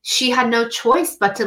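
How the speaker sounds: ordinary talk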